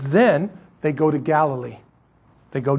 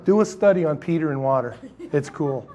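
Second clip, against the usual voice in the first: usual speaking voice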